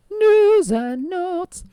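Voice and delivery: high, slow drawn-out announcement; drawn-out announcer. Acted. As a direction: stretch it out like an old radio jingle. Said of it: "keep up this old-timey voice".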